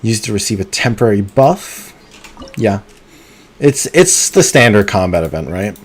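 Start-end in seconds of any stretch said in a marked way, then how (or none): none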